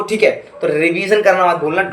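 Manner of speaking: ordinary talk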